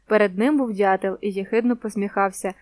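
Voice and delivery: ordinary delivery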